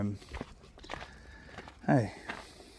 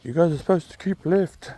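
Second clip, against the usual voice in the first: higher, louder grumpy cyclist voice; upset cyclist; 6 semitones higher, 6 dB louder than usual. Retold or imitated. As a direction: snap it like an annoyed cyclist. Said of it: "I assume he said".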